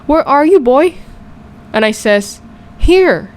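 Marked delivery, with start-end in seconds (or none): 0.1-0.9 raised-pitch fatherly shout. A narrator's reading. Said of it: "and he shouts". 2.8-3.3 put-on high-pitched invisible child answering; higher, piping "over here" reply; child calling out. A narrator's reading. Child calls back to searching father.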